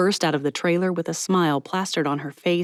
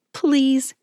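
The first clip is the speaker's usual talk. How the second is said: high, louder squeak; 8 semitones higher, 4 dB louder than usual. Acted. Mallory begging Ezra to go, squeaked. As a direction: squeeze it out in panic. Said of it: "squeaked out a".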